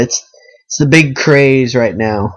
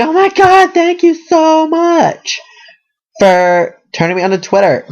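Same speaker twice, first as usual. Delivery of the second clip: very high, slow, squealed gratitude; giddy gushing thanks; 14 semitones higher than usual. Pretend. Imagined friend thanking him for Twitter, squealing. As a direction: squeal it like a starstruck fan. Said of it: "we all talk like that in my imagination".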